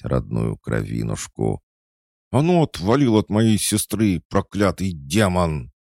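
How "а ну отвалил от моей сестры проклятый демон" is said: much higher, louder battle yell; furious shout. A narrator's reading. Sister charges the demon with a stick, furious shout. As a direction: scream him off your sister.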